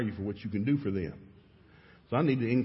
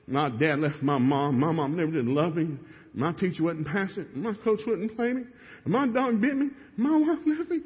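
mock whine climbing higher and higher; mock self-pity; 5 semitones higher, 3 dB louder than usual. Man at the retreat listing his excuses, a mock whine rising high. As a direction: ham up the sad-song excuses, rising to a high wail. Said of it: "How long do you wanna sing a sad song".

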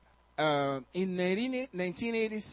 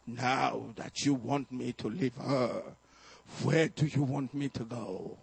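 put-on man's voice from the girl; 6 semitones lower than usual. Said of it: "kept repeating the same thing".

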